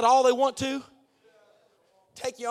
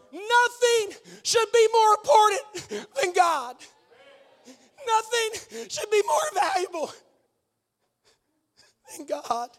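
high, slow, loud proclamation; crying out that nothing tops God; slow, shouted climax; 9 semitones higher, 5 dB louder, slower than usual. Real, not acted. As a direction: drag each word out with force. Preacher's climax.

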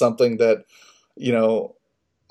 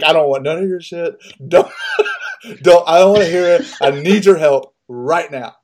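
loud, urgent barked demand; barking at wife; 5 semitones higher, 7 dB louder than usual. Retold or imitated. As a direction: cut her off before she can scold. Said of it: "barked at her".

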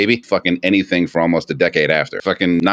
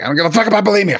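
high-pitched, exasperated mock outburst; exasperated retort; 11 semitones higher, much faster than usual. Fed up with every conversation returning to bulimia, exasperated. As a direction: act out being fed up, pitch jumping up.

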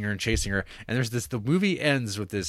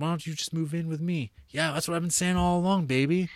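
lightly voiced couple, pitched higher; a hint of sweet-talking lovers; lovers' chat; 8 semitones higher than usual. Lovers making plans, quoted.

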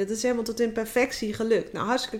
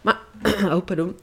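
usual speaking voice

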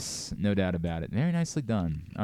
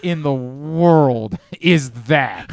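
slow, loud, drawn-out disbelief; incredulous; 3 semitones higher, 9 dB louder, much slower than usual. Real, not acted. Host stunned by a cohost's ancient trivia answer, drawn-out disbelief. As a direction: stretch it out like you can't believe it.

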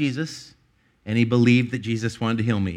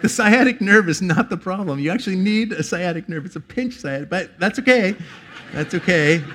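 laughing; 7 semitones higher, 4 dB louder than usual. Retold or imitated. Laughing retelling of own reply.

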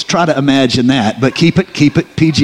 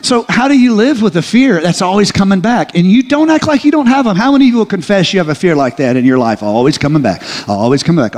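building preaching; 5 semitones higher, faster than usual. Real, not acted. Preacher rousing the congregation about fear, rising preaching. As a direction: drive the point home to the crowd.

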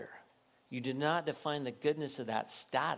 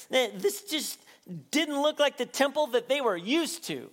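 ordinary delivery

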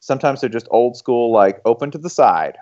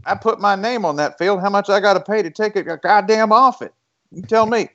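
high-pitched indignant booster; hammed-up huffy Texan, pitched way up; Joe Jamel; 10 semitones higher than usual. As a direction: imitate an offended rich Texan demanding answers. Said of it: "Joe Jamel will be like".

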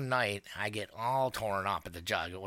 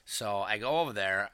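usual speaking voice

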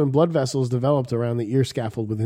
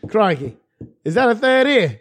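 much higher mock Aussie question; bad Australian accent; 7 semitones higher than usual. Teasing about the third ear in a bad Australian accent. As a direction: ham up a cartoon Australian accent. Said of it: "You guys are really bad".

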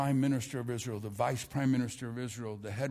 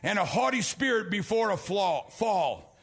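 full-force preaching; 9 semitones higher than usual. Acted. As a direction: preach it hard and ring out every line. Pastor preaching to congregation.

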